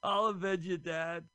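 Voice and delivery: heroic movie vow. Pretend. Hero answers dying father.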